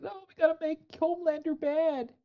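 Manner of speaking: high, whiny mocking voice; mocking high whine. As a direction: sneer it like a smug showrunner. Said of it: "they were like".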